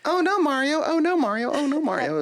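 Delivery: high squeaky repeated panic; high squeaky Toad cry. Retold or imitated. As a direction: squeal like a tiny mushroom helper. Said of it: "saying like".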